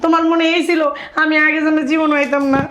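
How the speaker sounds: loud, high weepy accusation; hurt, crying complaint; tearful reproach. Acted. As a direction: reproach them through tears. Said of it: "কান্নাকাটি কেন করতেছো".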